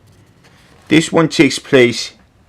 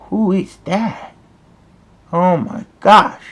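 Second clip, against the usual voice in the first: higher, hushed smitten awe; Daddy Derek; 7 semitones higher than usual. Acted. Cat's dad spotting a beautiful waitress, high hushed amazement. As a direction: gasp it slowly, as if love-struck.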